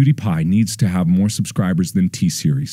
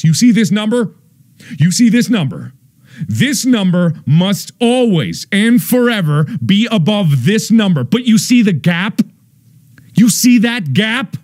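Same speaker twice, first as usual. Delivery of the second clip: alarmed urging; 10 semitones higher than usual. Acted. Pointing at sub count, alarmed.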